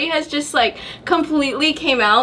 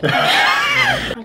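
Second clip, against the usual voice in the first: high, slow, laughing call; laughing shout. Real, not acted. Excited, laughing announcement.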